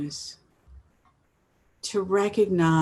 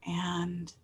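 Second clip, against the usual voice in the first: guided meditation. Acted. Host inviting listeners to settle in, unhurried guided meditation. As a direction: ease them into gratitude, leaving space between phrases. Leads an opening gratitude meditation.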